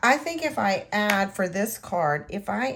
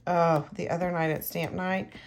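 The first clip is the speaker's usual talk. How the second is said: ordinary talk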